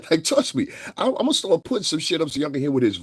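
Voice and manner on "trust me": normal talking voice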